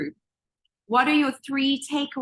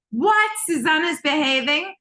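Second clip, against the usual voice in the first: loud, high incredulous outburst; mock shock; 3 semitones higher, 3 dB louder than usual. Coach teasing herself about behaving, mock shocked. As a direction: pretend to be scandalised.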